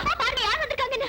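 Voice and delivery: high, fast and giggling; bubbling over with excitement, calling father; excited giggly call. Acted. Daughter calls father excitedly, high and giggling.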